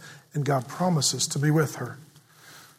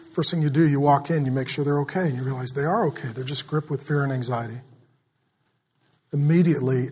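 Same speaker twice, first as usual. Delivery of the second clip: ordinary delivery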